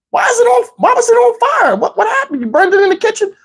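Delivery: high-pitched baffled panic, acted out; comic alarm, voice jumping way up; alarmed questioning. Acts alarmed questions about burnt sage.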